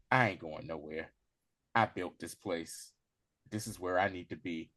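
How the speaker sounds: low, measured retold stand; firm refusal. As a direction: dig in quietly, voice dropped. Retold stubborn refusal to leave.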